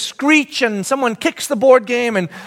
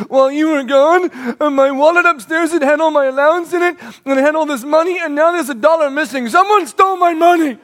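put-on upset little girl; 5 semitones higher than usual. Tearful daughter explains.

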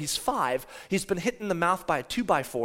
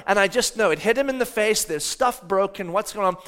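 usual speaking voice

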